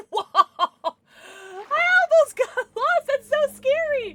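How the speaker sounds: high, loud squeal of fright and thrill; excited shriek. Real, not acted. Lost in the cave, she squeals high and loud. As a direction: shriek it half laughing, half scared.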